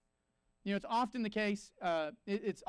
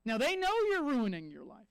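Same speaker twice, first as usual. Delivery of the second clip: ordinary talk, nothing marked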